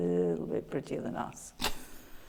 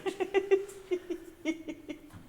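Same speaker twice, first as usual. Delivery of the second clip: wheezy laugh; 13 semitones higher than usual. Real, not acted. Laughing after "she's stupid.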